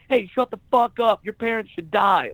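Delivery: overreact on purpose; hammed-up furious overreaction; overblown comeback. Shown as a demonstration. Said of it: "You don't respond with".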